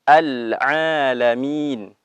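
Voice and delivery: slow al-alamin with clear ain; Quranic recitation. Shown as a demonstration. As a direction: draw out al-alamin, ain from the throat. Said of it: "Kemudian lafaz". (bacaan pelan dengan ain jelas)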